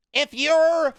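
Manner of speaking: high, slow, halting impression; David Lynch. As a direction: draw out each phrase in a high put-on voice. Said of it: "the David Lynch voice".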